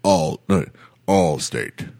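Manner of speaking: low, drawn-out Allstate lady voice; Flo voice. Retold or imitated. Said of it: "How's this sound?".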